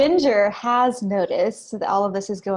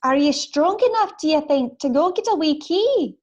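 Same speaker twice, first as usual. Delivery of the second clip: put-on high, accented character voice; prisoner character voice; 7 semitones higher than usual. Prisoner asks the pixie to fetch keys, high accented voice. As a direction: ask a favor in a pitched-up, accented voice. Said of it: "so I ask".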